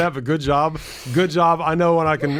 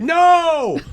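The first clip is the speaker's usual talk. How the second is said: high, loud "no" of exasperation; real frustrated yell, pitched way up; frustrated shout; 8 dB louder than usual. Real, not acted. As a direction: yell it out at the misbehaving computer. Fighting computer pop-up window.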